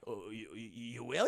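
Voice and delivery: low, stammering disbelief; acted hesitant gangster; timid relief. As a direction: falter softly, barely daring to hope.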